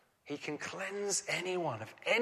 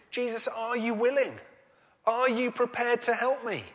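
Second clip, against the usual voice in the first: pleading leper; 6 semitones higher, 4 dB louder than usual. Acted. Voicing the leper's doubting questions.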